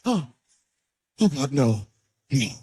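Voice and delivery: mock terrified plea; horrified woman. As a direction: recoil in disgust and horror. Victim's horrified reaction.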